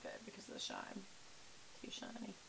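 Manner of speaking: under her breath, half to herself; whispered aside. Real, not acted. Vlogger muttering about the glare in a whisper.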